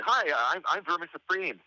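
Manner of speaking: high, chirpy mock introduction; high-pitched self-introduction, playfully acted out; eager greeting. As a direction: re-enact an eager hello, pitched well up. Re-enacts greeting a candidate.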